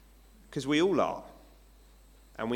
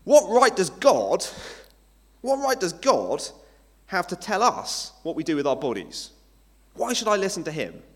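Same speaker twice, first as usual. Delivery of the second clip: loud, raised-pitch protest; knee-jerk indignation; 5 semitones higher, 8 dB louder than usual. Acted. Objector reacting to God's say over bodies, indignant. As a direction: throw it out as an affronted challenge. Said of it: "a kind of knee-jerk reaction".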